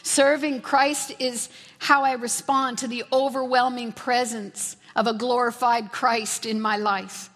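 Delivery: ordinary talk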